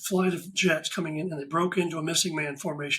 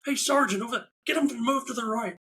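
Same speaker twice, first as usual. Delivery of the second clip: higher-pitched barking of orders at a group photo; retold yelling; 7 semitones higher than usual. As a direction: call out directions across the tarmac, a bit higher. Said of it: "and I'm yelling".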